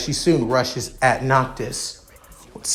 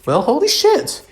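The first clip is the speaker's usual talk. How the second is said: high, louder startled exclaim; surprised; 10 semitones higher, 3 dB louder than usual. Acted. Noctis surprised the healing brought the wings back.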